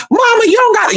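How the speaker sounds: high, prim mock complaint; imitated scolding aunties. Aunties telling grandma not to cuss, high mock scolding. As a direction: mimic a fussy aunt way up high.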